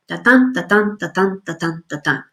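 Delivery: "Da-dun" beaten out evenly, stress on each "dun"; iambic rhythm demo. Shown as a demonstration. Lecturer showing students the meter, "da-dun" in iambic rhythm. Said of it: "iambic pentameter".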